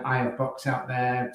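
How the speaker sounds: ordinary talk, nothing marked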